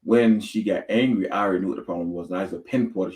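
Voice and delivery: usual speaking voice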